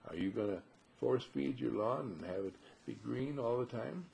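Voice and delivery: ordinary talk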